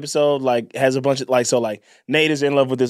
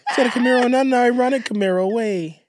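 high mocking girly voice; 10 semitones higher than usual. Voicing Serena mockingly.

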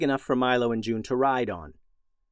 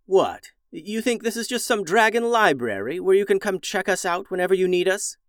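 annoyed dragon voice; 7 semitones higher than usual. Acted. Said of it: "A nearby dragon piped up".

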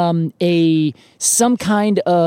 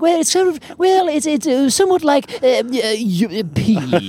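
high, hesitant, overly proper hemming; stuffy formal gentleman; 8 semitones higher than usual. Retold or imitated. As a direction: play a fussy Royal Society scholar. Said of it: "That's your Royal Society character".

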